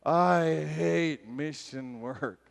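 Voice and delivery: very slow, drawn-out complaint; exasperated grumble. Retold or imitated. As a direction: stretch every word in acted misery. Acted complaint, woken at night.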